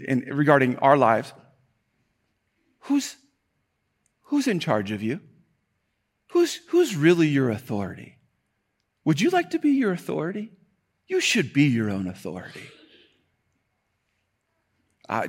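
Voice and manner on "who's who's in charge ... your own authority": tempting devil. Acted. Voicing the devil's tempting questions.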